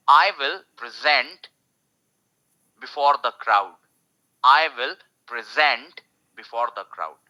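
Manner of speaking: slowly, verb stress on zent; slow verb demo. Shown as a demonstration. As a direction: present said pri-ZENT, slow. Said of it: "gent पे focus आ गया".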